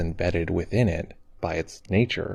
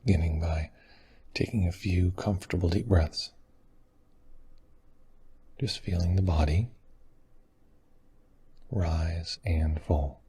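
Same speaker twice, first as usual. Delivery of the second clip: guided meditation; 4 dB quieter than usual. Acted. Teacher leading meditators to arrive in this moment, guided meditation. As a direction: guide them gently, phrase by phrase.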